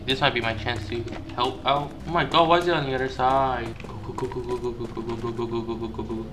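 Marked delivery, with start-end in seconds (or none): 3.9-6.2 soft, rapid-fire repeated muttering; hushed urging. Real, not acted. Player sees a chance to help, hushed urging. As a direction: urge yourself on under your breath. Urging self during escape.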